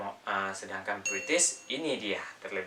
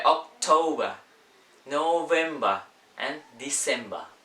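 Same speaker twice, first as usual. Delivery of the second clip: British accent, final r dropped in each month; higher, louder British "-ba" endings; British pronunciation; 3 semitones higher, 6 dB louder than usual. Shown as a demonstration. Teacher contrasting British with American months, British accent. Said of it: "di British".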